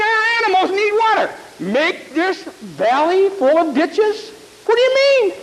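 high, disbelieving king voices; baffled kings. Acted. Kings protesting to Elisha, high and incredulous. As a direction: kings spluttering at a strange order.

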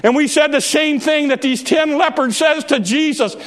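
shouting sermon climax; full-force preaching. Acted. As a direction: preach it out to the back row.

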